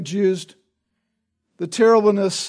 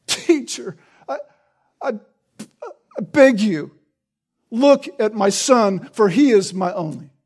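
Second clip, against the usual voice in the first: pleading father. Shown as a demonstration. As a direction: beg the teacher with a catching voice. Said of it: "something like this".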